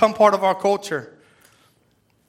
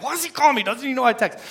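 higher, exasperated mock complaint; annoyed texter; 5 semitones higher, faster than usual. A friend annoyed at being phoned, mock exasperated. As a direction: play the annoyed friend who only texts. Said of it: "You'll be like".

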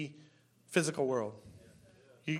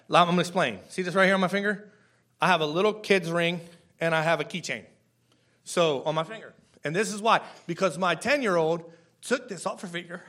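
ordinary talk, nothing marked

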